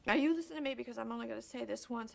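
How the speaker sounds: high, forceful opening that settles down; stern warning. Acted. Parent saying this only once, stern. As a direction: demand to be heard, hitting the first words hard. Stern confrontation about the father.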